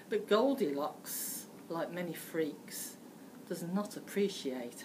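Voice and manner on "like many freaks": ordinary delivery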